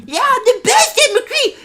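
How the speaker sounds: high, loud squealing yell; shrieky little-kid trash talk; screaming kid gamer. Retold or imitated. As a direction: imitate a kid screaming into the mic at the top of his lungs. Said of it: "screaming at the top of the lung".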